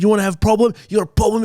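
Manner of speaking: retold angry threat at the mechanic; confrontational. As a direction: square up to the mechanic. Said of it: "And I just lost it".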